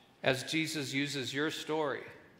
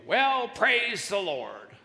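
slow, drawn-out porch proclamation; devout elderly lady; 5 semitones higher, 4 dB louder, much slower than usual. Acted. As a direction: sing out praise as a pious old lady, slowly. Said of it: "she'd go".

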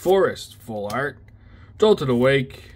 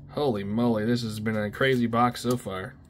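normal talking voice

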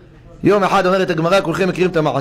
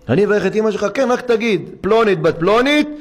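high, eager pleading voice; urging a friend to bless his mother; 6 semitones higher than usual. Retold or imitated. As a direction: coax him warmly, pitched up high.

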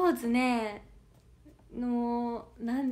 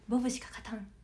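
whisper; 3 semitones lower, 6 dB quieter, faster than usual. Shown as a demonstration.

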